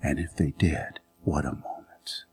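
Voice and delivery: low, whispered musing; hushed wonder. Real, not acted. Preacher pondering whether their eyes met, hushed. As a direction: draw them in with a whisper. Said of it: "hushed wondering".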